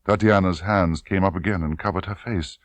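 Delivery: usual speaking voice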